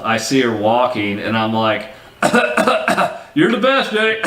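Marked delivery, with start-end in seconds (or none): 2.2-3.3 harsh smoker's cough; coughing fit. Retold or imitated. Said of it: "I'm coughing a little bit". 3.3-4.2 coughing through the line; cigarette in mouth. Retold or imitated. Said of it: "I'm coughing a little bit".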